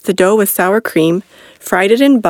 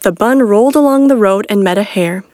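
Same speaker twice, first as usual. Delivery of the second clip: plain reading voice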